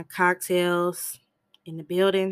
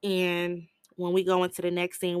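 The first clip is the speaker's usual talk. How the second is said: ordinary talk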